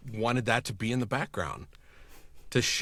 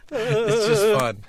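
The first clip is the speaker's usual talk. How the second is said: laughing; 12 semitones higher, 5 dB louder, slower than usual. Real, not acted. Delighted laugh about the work.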